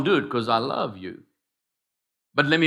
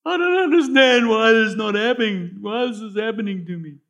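lightly voiced, high complaining believer; whining believer; 8 semitones higher, 4 dB louder than usual. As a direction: play a believer moaning at his trials.

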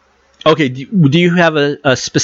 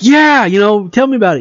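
higher-pitched, mildly keen quote; eager retold reply; 7 semitones higher, faster than usual. Reviewer quoting the character's reply about the spider, eager. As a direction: lightly act out an eager listener.

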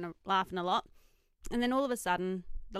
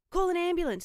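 a firm retold yell; yelled order; 10 semitones higher, 5 dB louder than usual. Shouting to the waiter, a retold urgent call. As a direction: call it across the room, urgent. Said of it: "I yelled at the waiter".

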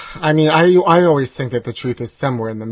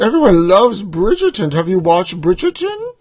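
higher put-on posh accent, gushing; 6 semitones higher, 4 dB louder than usual. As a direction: gush about the show in a fake posh English accent. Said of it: "the accent that you're using".

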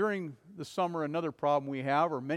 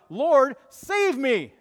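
much higher, loud, slow cry for rescue; desperate cry; 8 semitones higher, 11 dB louder, much slower than usual. Acted. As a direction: cry out like a drowning man. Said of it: "he immediately cried out".

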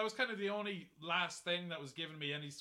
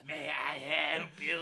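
waiter voice; 5 dB louder, slower than usual. Acted. Waiter role voice.

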